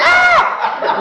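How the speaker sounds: very high mock shriek; short piercing scream, replayed on request for comedy; imitated scream. Wife screaming again for the do-over, mock scream. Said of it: "She screams again".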